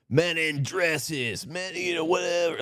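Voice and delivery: sneering imitation of bigots; mocking slur. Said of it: "making fun of transgender people".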